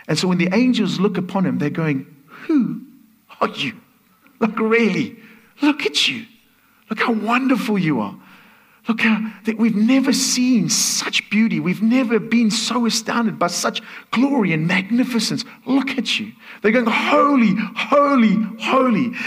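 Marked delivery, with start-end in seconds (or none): 2.5-16.3 high, excited wonder as the angels; awestruck angels. Acted. 16.9-19.1 high, loud holy cry; angels crying out. Acted.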